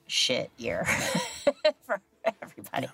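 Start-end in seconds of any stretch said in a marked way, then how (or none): 0.9-1.8 rueful laugh. Real, not acted. Laugh at shit year.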